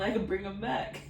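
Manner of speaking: ordinary talk, nothing marked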